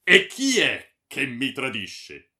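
loud, slow, outraged question; angry demand. A narrator's reading. Baron confronting the friar about a betrayal, outraged. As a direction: demand the traitor's name at full voice.

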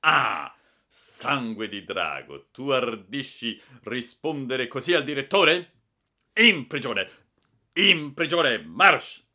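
loud, high furious sentencing; infernal rage. Acted. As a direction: roar the punishment. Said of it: "i baffi... ballare una rida infernale".

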